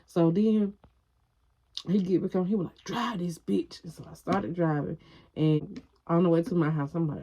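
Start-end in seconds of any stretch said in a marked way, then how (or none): 2.8-3.8 slow, soft, menacing command; cold slow order. Retold or imitated. Boyfriend ordering her to drive, quiet menace.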